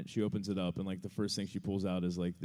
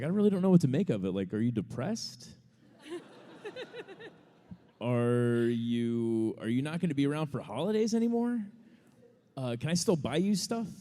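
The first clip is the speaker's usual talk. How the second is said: worried mom; 6 semitones higher, 4 dB louder than usual. Retold or imitated. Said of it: "she's like".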